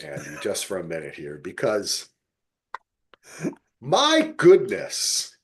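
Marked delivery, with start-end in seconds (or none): 3.8-5.4 swooning. Real, not acted. Swooning over Minka Kelly.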